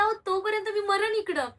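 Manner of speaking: retold high-pitched urgent protest. Patient retelling her plea to hospital staff, urgent protest.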